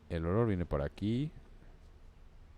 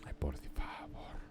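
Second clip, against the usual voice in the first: hushed, appalled whisper; whispered dismay; 4 semitones lower, 8 dB quieter than usual. Real, not acted. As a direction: breathe it out in disgust. Reacts to child's femur.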